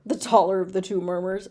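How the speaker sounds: usual speaking voice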